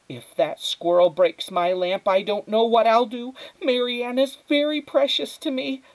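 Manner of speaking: high, loud, slowing plea; worked-up mother voice; fretting, overwrought mother. A narrator's reading. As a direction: warn them anxiously about a treasured thing. Voices the mother fretting over lamp.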